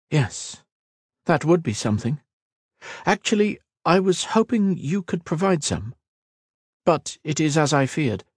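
high, light, pensive voice; musing elf. Acted. Kira admitting she lacks answers, musing elf. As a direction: ponder aloud, pitched well up.

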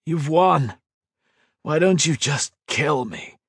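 a lightly voiced broken young man; defeated hero. A narrator's reading. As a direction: give up, worn out. Beaten hero pleading.

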